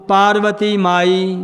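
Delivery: Parvati ਮਾਈ drawn out very slowly; slow model phrase. Shown as a demonstration. Teacher models the line slowly for the class. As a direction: say it slowly so the class can follow. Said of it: "ਇੱਥੇ ਵਿਸਰਾਮ ਲੱਗ ਗਿਆ".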